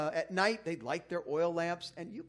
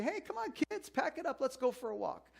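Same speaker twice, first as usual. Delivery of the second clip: put-on much higher, chirpy dad calling the kids; cheery parent; 8 semitones higher than usual. Dad calling his kids for a holiday walk, high and chirpy. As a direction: sing it out like a keen dad.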